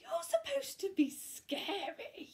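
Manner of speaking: high, disappointed witch; lightly voiced witch regret; dismayed witch. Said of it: "witch dismayed".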